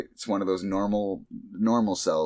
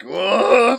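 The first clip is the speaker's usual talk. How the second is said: much higher, louder excited whoa; macho whoa; 8 dB louder than usual. Retold or imitated. Powered-up cell showing off, loud macho whoa. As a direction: belt it like Johnny Bravo. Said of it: "And he's like".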